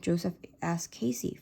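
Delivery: usual speaking voice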